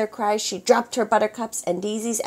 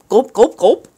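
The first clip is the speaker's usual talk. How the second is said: gulping sounds; 4 semitones higher, 9 dB louder than usual. A narrator's reading. Pirates swallowing noodles.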